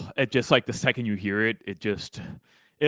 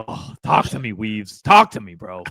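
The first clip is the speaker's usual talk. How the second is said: mock-urgent cheer, loud burst on the second talk; hyped fan plea; 7 dB louder than usual. Fan gushing over the coach's voice, hyped plea. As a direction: egg him on, then drop off on bro.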